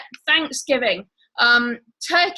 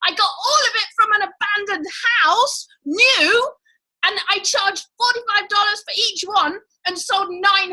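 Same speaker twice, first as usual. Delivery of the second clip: lightly mocking a friend who sells anything; mock Catherine; 5 semitones higher than usual. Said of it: "She's like".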